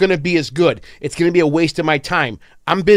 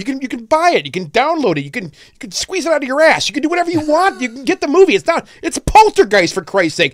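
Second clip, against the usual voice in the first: exasperated rant; 11 semitones higher than usual. Real, not acted. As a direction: rant it out, fed up. Ranting about remakes.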